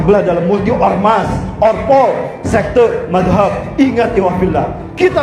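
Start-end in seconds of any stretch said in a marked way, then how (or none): none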